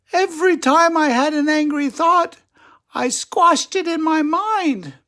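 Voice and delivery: very high, sweet little squirrel voice; calm squirrel lady. Acted. Squirrel greets Attila at the club, very high voice. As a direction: play a tiny serene squirrel. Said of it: "said Angelica".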